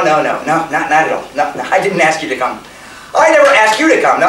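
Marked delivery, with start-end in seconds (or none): none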